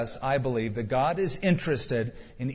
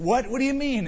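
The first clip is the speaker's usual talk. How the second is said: put-on startled kid; 9 semitones higher than usual. Boy caught by mom.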